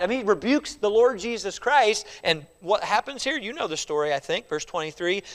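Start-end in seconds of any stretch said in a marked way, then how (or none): none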